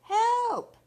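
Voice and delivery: high, loud cry for help; Chucky yelling. Acted. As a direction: yell it long and high. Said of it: "Chucky yelled".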